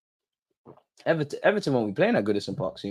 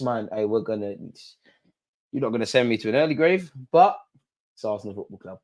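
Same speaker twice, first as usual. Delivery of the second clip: ordinary delivery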